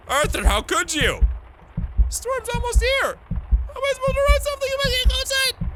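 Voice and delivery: high, loud, melodramatic wailing; theatrical panic. Acted. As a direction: play a frantic composer cracking under pressure. Acting out the composer panicking at pressure.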